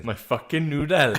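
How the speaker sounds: slow, drawn-out Welsh accent. Shown as a demonstration. Friend shows the extent of his Welsh, drawn-out Welsh accent. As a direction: play a Welsh catchphrase for laughs, stretching it. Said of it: "That's the extent of my Welsh".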